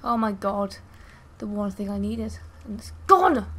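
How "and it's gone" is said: loud lament, punched on the last word; exasperated outburst. Real, not acted. Player losing the one thing they needed, exasperated outburst. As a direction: burst out loudly at the loss.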